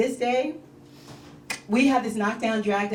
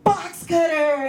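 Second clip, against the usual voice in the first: high, drawn-out mock protest; mock arguing; 6 semitones higher, slower than usual. Comedian imitating husband in their fight, mock arguing. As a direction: imitate an insistent spouse, high and stretched.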